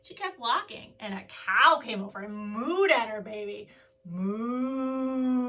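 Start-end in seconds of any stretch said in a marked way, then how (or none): 4.0-5.5 a hint of a cow moo. Teacher voicing the cow at the turtle babies, light moo.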